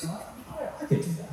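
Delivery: hushed, breath-only murmur to himself; mock musing. Preacher replays himself watching the show, hushed musing. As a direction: think aloud under the breath.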